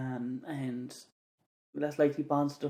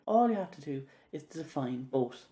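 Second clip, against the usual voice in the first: normal talking voice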